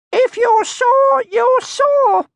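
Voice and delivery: put-on very high, slow tiny girl voice; tiny cheeky creature voice; little Mai. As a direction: squeak it like a small bossy girl. Little Mai's voice.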